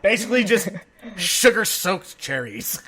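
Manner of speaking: laughing. Real, not acted. Laughing at friend's cherry story.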